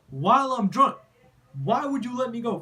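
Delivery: loud, high, indignant outburst at the cousin; comic fake anger at being left behind; mock outrage. Real, not acted. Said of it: "It seems like I'm mad, but I'm really not mad".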